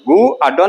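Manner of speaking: गुड pulled apart as "गु आ डो"; गु and डो as separate pieces instead of one गुड; wrongly split word. Shown as a demonstration. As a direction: demonstrate the mistake, गु then आ डो. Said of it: "नहीं बोलते हैं".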